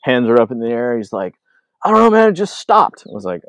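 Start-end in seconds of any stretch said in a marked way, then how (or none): 1.8-3.0 high-pitched imitation of a baffled buddy; baffled friend. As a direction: mimic him, high and helpless. Baffled rider's sled breaks down.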